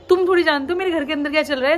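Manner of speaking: ordinary talk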